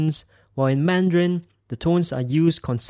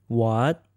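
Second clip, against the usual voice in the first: WHAT starts low and rises; rising tone; 3 semitones lower than usual. Shown as a demonstration. Teacher demonstrates tones with English sounds, rising tone. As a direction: lift WHAT upward like a question. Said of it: "repeat the English sounds after me".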